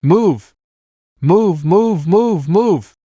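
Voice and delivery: high, insistent rhythmic shout; shouted order. A narrator's reading. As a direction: drive the workers on, word by word. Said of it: "he shouted".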